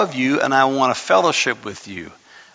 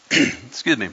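ordinary delivery

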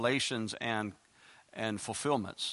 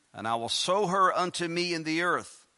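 lightly raised reading voice; scripture reading; 5 semitones higher, 5 dB louder than usual. Acted. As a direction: read the verses out like a preacher. Reads scripture aloud.

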